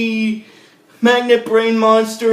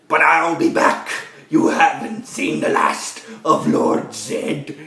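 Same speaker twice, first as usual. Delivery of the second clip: low, slow villain threat, half whispered; hammed-up menacing farewell, pitch dropped right down; Zed; 7 semitones lower, slower than usual. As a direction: imitate a defeated villain vowing revenge in a deep, whispery voice. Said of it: "he's like".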